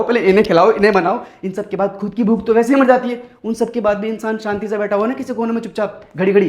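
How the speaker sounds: ordinary talk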